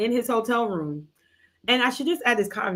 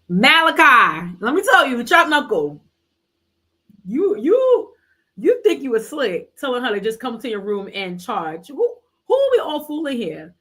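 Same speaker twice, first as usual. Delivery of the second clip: high, loud mock accusation; calling out Malachi; 4 semitones higher, 4 dB louder than usual. Real, not acted. Calling out Malachi for his sneaky room invite, high and loud. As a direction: call him out like you caught him.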